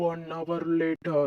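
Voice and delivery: very slow, soft announcer aside; scene announcement. Acted. Skit narrator marks the time jump, slow and quiet.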